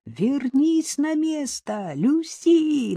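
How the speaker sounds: high, drawn-out calling cry; strained high-pitched holler without real volume; anguished yell. A narrator's reading. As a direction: call the dog back desperately, stretching the words. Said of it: "надрывным голосом завопил её хозяин".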